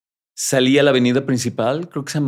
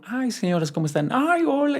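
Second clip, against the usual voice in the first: put-on high sugary greeting to old ladies; sweet ladies; 14 semitones higher, 4 dB quieter than usual. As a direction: play the charming ladies, voice lifted high.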